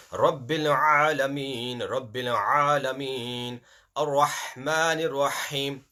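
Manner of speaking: slow, drawn-out recitation. Shown as a demonstration. Teacher reciting slowly so students hear the two ha's. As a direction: stretch each syllable for the class. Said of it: "The two ha's are there".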